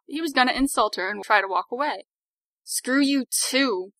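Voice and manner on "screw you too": slow hiss through teeth. A narrator's reading. Victoria hissing at the retreating skinhead. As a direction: spit it out slowly through clenched teeth. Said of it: "she hissed through her teeth".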